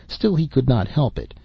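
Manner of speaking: usual speaking voice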